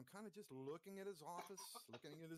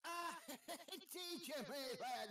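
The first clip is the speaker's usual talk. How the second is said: high, loud cackling gnome; gnome laughing; 10 semitones higher, 4 dB louder, slower than usual. Acted. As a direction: laugh it off with glee.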